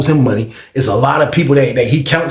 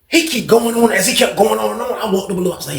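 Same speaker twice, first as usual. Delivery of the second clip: normal talking voice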